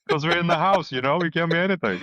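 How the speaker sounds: high, laughing through the words. Real, not acted. Guest joking with the host about the mishap, laughing. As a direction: still giggling as he says it.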